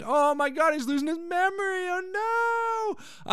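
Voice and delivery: very high, hammed-up dismay; mock horror. Host reacting to Frasier losing his memory, mock horror. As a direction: wail in fake panic, pitch climbing.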